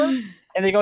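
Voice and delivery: ordinary talk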